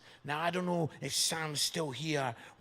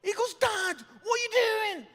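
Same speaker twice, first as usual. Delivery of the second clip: a son's disbelief, pitched way up; high startled outrage; shocked son. Retold or imitated.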